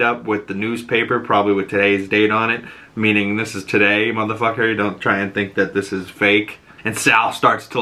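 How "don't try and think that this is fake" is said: ordinary delivery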